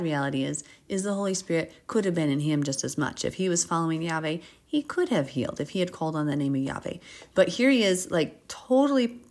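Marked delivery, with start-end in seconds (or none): none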